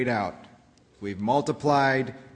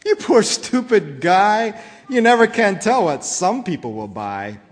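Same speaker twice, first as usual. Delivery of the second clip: higher, louder scornful jeer; mocking Onceler; 6 semitones higher, 7 dB louder than usual. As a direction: sneer down at the Lorax. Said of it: "I laughed at the Lorax".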